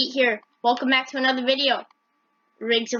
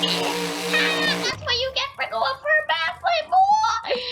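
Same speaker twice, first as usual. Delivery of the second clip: much higher mocking taunt, ending in a yelp; gloating Toddy Lee; 13 semitones higher, slower than usual. Toddy Lee taunting the flipped driver, high squeaky gloat. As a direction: jeer at him like a cartoon sidekick. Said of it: "Toddy Lee is like".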